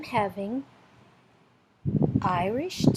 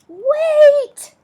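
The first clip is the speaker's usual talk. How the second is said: much higher, loud sudden cry; shouting bear; 13 dB louder than usual. Acted. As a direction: call out in alarm. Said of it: "And the bear says".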